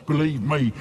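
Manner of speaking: ordinary delivery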